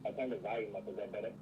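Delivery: muffled remote voice. Real, not acted. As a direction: voice muffled by headphones. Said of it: "It's just a little muffled".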